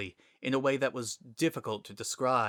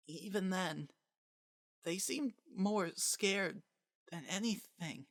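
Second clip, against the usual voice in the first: put-on high, soft, slow child voice; a frightened little boy barely speaking; weak small child; 9 semitones higher, 8 dB quieter than usual. Azriel the child speaks weakly.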